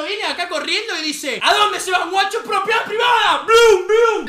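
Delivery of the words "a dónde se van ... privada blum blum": loud, raised mock-threatening shout; angry challenge. Rugby player running at the intruders, acted angry shout. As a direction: charge in yelling, then imitate the blows.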